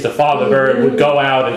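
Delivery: normal talking voice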